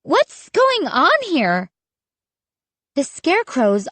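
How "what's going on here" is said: high, amazed question; crying out. A narrator's reading. Child baffled by the scarecrows, crying out high. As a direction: cry it out in bewilderment. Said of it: "I cried".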